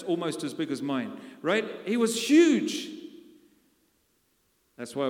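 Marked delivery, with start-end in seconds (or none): 1.9-2.9 HUGE stretched out high, loud and slow; booming emphasis. Acted. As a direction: lean hard on huge, draw it out. Preacher stressing Goliath's size.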